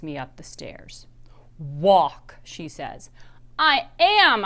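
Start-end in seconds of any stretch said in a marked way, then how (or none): 1.6-2.4 stern whispered order. Acted. Angry mom dragging her upstairs. 3.6-4.4 high, loud protest; child protesting to her mom, crying out high. Acted. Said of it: "I cry".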